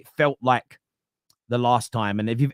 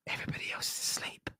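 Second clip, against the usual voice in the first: real hushed whisper; 16 dB quieter than usual. Real, not acted. Streamer whispering late at night so the house stays asleep. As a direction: breathe it so nobody wakes.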